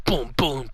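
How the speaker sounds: whispered boom boom; whispered punch sounds. Pretend. Mock punching sound effects.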